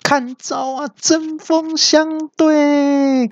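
very high, slow, stretched battle cry; insulted villain shrieking his attack; furious yell. A narrator's reading. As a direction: howl the move name like an offended brute. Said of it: "三怪听到就大叫说".

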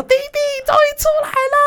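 very high-pitched imitated yell; mock excited yell. Sister calling out on seeing brother released, mock excited yell. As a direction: imitate a shrieking overjoyed greeting. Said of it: "就大喊说".

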